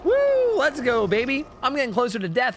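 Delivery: high, excited whooping celebration; hyped-up cheer. Retold or imitated. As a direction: cheer about getting older. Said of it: "You go".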